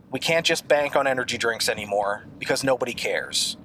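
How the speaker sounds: usual speaking voice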